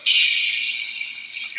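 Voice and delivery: breathy shh of a sliding cover; mock mechanical whoosh; hushing sound. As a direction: imitate the pool opening with a soft shh. Imitated sound of pool opening.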